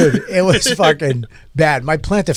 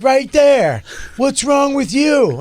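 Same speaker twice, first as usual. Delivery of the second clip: mock callout; 7 semitones higher, slower than usual. Comic loudly calls out audience member.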